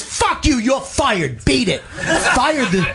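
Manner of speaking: high-pitched, abrupt mock outburst; imitated angry firing. Rehab boss fires staff member, mock angry outburst. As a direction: play a lunatic boss cutting someone off.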